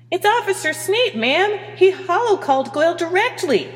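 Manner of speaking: very high, squeaky officer; squawky report. Acted. Communications officer reports Snape to the captain, squawky.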